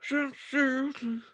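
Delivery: high, slow robot lady voice. Retold or imitated. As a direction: drag each word out like a robot singer. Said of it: "I can't do a robot voice".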